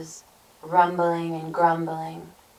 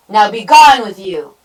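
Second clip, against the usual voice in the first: loud dismissal; 3 semitones higher, 14 dB louder than usual. A narrator's reading. Troll dismisses the goat.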